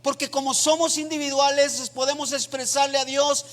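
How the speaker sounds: loud, high, fervent preaching voice, near chanting; full-force preaching. Acted. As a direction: preach it out to the whole church, impassioned. Predica a toda fuerza. (predicación a todo pulmón, voz alta y ferviente)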